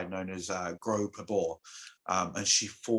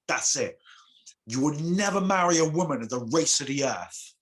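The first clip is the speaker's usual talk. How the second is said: higher, a little louder final curse; livid curse; 6 semitones higher, 3 dB louder than usual. Acted. Livid goddess cursing the boy again, higher and louder. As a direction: lay down the last curse in anger. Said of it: "So she's livid once again".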